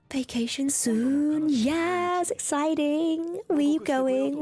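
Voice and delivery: high, slow delighted gasp and cheer; gasp, then stretch the words out with glee; excited gasp. Real, not acted. Streamer thrilled about a viewer's upcoming vacation.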